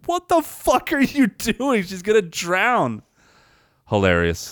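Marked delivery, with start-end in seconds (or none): 0.1-3.0 high, slow, horrified what-the-fuck; shocked outburst. Retold or imitated. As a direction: yell at the screen in disbelief. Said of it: "I was like".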